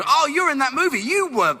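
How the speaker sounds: high-pitched imitation of blunt Australian moviegoers; Australian critics. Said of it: "whenever anyone comes to us from Australia".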